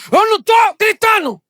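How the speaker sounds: high, very loud yell; shouted denial. Acted. Wife answering her husband's "fala baixo", shouting. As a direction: scream it while denying you scream. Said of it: "começa a falar alto".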